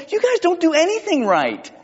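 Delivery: high, exasperated scolding; frustrated. Acted. Preacher paraphrases James scolding his readers, frustrated. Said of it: "can you hear the frustration in James' voice".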